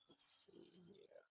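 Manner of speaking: slow breathy whisper to self. Real, not acted. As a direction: whisper it under your breath, slowly. Muttering while painting.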